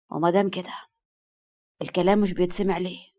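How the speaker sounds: restrained, stern male scolding; a touch of anger, kept low; mildly angry. A narrator's reading. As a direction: demand a reason with contained anger. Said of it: "سلطان بغضب بسيط".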